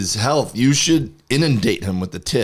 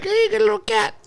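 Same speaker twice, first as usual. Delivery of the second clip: cutesy voice. Retold or imitated. Mock voice for someone talking to cat pop.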